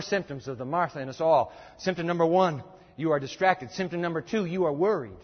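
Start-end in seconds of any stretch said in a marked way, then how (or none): none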